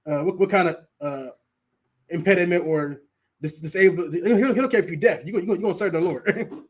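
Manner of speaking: ordinary delivery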